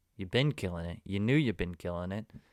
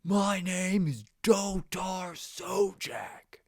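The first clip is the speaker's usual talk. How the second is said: high, slow heroic proclamation; confident declaration; 9 semitones higher, much slower than usual. Pretend. Telling viewers to proudly declare their Mars name. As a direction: announce it with total bravery. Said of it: "says it with such confidence".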